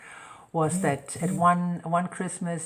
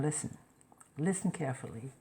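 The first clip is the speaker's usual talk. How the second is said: quiet stern warning; 2 semitones lower, 7 dB quieter, faster than usual. Retold or imitated. Mother giving a stern warning.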